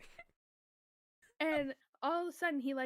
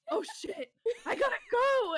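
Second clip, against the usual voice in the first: high, loud comic alarm; acted-out cry of alarm at a crash; panicked yell, re-enacted; 10 semitones higher, 7 dB louder than usual. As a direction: yelp it in a sudden panic, half laughing. Retells panicking at friend's crash.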